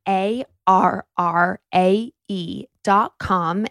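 each letter spelled slow and loud; spelled out slowly. Shown as a demonstration. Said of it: "That's arrae dot com".

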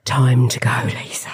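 breathy whisper to herself; barely voiced, just for herself; whispered aside. Whispering to herself about leaving.

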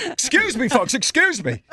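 high, put-on excuse me; pushing through the crowd to hold the table, mock-polite; pushy polite. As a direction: squeeze past the crowd with fake politeness.

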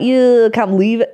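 mock northern accent. Shown as a demonstration. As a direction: attempt at a northern English accent. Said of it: "we do northern accents".

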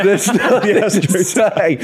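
slow, high giggling reply; laughing. Real, not acted. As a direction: giggle the words out. Giggling, avoiding teaching.

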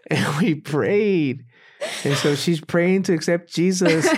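high, loud, building excitement; elated testimony. Real, not acted. Climax of conversion story, hosts exclaim.

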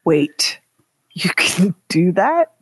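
voiceless, drawn-out disbelief; hushed mock amazement; stunned whisper. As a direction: whisper it slowly as if just finding out. Feigns amazement at toaster trick.

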